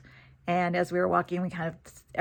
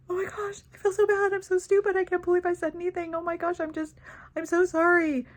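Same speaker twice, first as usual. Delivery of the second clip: high, flustered, gushing apology; mock-embarrassed friend apologizing in a high voice; flustered Susan; 12 semitones higher, 4 dB louder than usual. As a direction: play Susan mortified and tumbling over her sorries.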